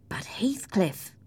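slow, hushed, half-voiced objection; protesting. A narrator's reading. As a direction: protest helplessly as he walks past. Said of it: "I protested".

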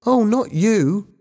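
high, slow schoolboy voice; reply high and slowly, as a boy; young boy. A narrator's reading. Ben corrects Bobby, young boy.

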